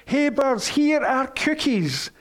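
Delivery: read-aloud version of a shout; mild storybook shout. A narrator's reading. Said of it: "he shouted in a loud voice".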